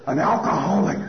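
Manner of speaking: loud, affronted exclamation; indignant outburst. Retold or imitated. Recovering parent phoning sponsor about the insult, indignant outburst. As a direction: blurt it out loudly, deeply affronted.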